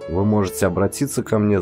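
usual speaking voice

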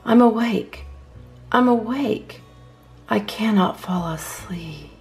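usual speaking voice

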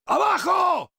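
high, stretched yell; warning shout. Acted. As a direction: yell for everyone to take cover. Warns squad of rockets.

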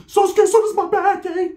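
put-on very high, slow slurred drunk voice; drunk homeboy. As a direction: play the wasted homeboy mocking him.

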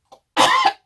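sharp choking cough; coughing fit. Real, not acted. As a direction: cough hard, swallowed wrong. Choking on ice cream.

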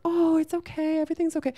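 high, placating coo; mock soothing feminine voice. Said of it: "going into your feminine being like".

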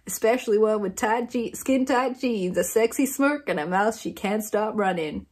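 hammed-up Southern US accent reading a blurb. Shown as a demonstration. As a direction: put on a playful Southern cowboy accent. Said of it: "should I be reading this in a southern accent?".

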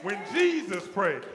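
loud, rising refrain, each line hit harder; preaching at full force. Acted. Preacher builds a shouted refrain over the organ.

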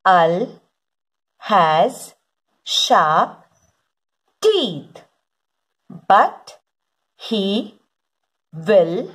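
deliberate early-reader storybook delivery, falling on each last word; slow word-by-word reading. Acted. Teacher reads to children following along, slow word-by-word reading. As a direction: read each word separately with long pauses.

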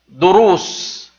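drawn-out dictation of دروس; slow clear dictation. Shown as a demonstration. Teacher dictates دروس slowly for students to circle. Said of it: "The second one or first one?".

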